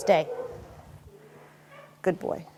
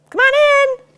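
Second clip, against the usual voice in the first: very high, loud doorbell hello; loud distraction hello; 12 dB louder than usual. Shown as a demonstration. Trainer testing the dog with a loud greeting, high and loud. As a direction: sing it out like greeting a guest at the door. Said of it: "when I say it".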